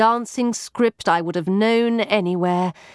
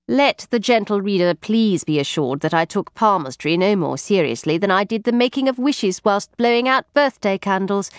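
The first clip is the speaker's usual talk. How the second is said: ordinary delivery, nothing marked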